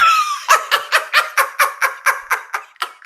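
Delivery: very high, unhinged cackle; maniacal laugh. Acted. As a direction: cackle like a madman. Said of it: "laughs like a maniac".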